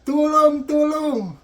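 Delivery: high-pitched hammed-up yell; shouting for help. Pinoy still begging the confused nurse, hammed-up shouting. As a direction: keep crying out for rescue. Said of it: "sigaw pa rin na sigaw".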